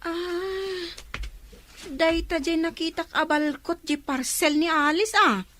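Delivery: ordinary talk, nothing marked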